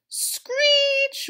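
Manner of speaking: very high, loud screech. A narrator's reading. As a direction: screech like metal. Toaster noise imitated.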